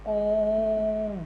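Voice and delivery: ओम stretched long, the vowel held three times as long; drawn-out Om. Shown as a demonstration. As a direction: hold the vowel in ओम, pluti style. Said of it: "इसमें हमें इससे तिगुना समय लगा है". (ओम को लंबा खींचकर बोलें)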